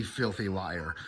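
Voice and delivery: ordinary talk, nothing marked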